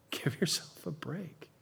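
hushed repeat; whispered echo of the plea. Real, not acted. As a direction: breathe it to them as a whisper. Repeated softly after exhortation.

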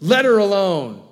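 sharp, loud, high rebuke; stern command. Pretend. Jesus loudly commanding Judas to leave her alone. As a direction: bark it out as a firm order. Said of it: "I don't know what the tone of voice was".

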